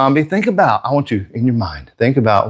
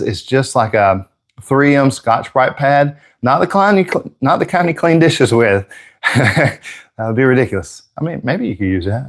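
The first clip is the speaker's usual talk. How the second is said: normal talking voice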